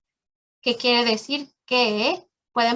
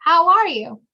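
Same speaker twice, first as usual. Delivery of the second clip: higher, livelier, as a friend would ask; bright friendly greeting; 6 semitones higher, 6 dB louder than usual. Shown as a demonstration. Teacher voicing a friend greeting her, bright and friendly. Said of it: "Y van a escuchar".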